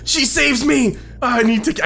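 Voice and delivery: loud, high burst of relief; excited relief. Real, not acted. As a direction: cry out at being rescued. Gamer rescued at last moment.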